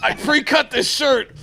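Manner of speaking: hammed-up high, loud wrestling promo shout. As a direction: bark it like a wrestler cutting a promo. Wrestling promo mock intro.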